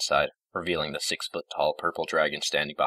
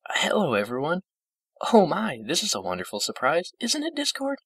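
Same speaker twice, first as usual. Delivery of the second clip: put-on high, sweet little old-lady voice; pleased surprise in a high, gentle voice; frail, gentle old pony; 13 semitones higher than usual. A narrator's reading. Fluttershy greeting visitors from her bed, high and delighted.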